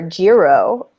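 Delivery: "gyro" drawn out slowly as YEE-roh; Greek yee-ro. Shown as a demonstration. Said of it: "'Cause you could say gyro".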